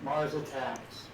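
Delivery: muffled, distant, like from inside a tunnel; bad mic, sounding far off in a tunnel; echoing tunnel. Real, not acted. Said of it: "sound like you're in a tunnel".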